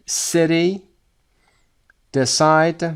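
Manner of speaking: ordinary talk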